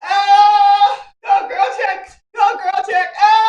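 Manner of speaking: high, loud cheering chant; excited cheer. Real, not acted. Host cheering along with the dance break, loud high cheer. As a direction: whoop it out to the beat.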